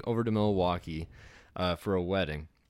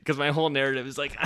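normal talking voice

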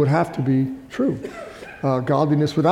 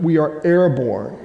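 normal talking voice

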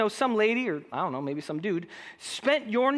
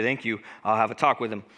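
much lower, mock-stern grown-up voice; faking a dad voice; 11 semitones lower than usual. Teen forging his dad's voice for the school, deep and stern. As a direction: drop the voice low like a serious father. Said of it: "I would try my best to say".

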